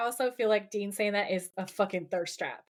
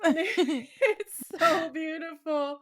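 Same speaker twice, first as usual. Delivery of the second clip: slow, high, half-crying laugh; laughing through tears; 6 semitones higher, much slower than usual. Real, not acted. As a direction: gush while laughing and tearing up. Overcome while recapping favourite scene.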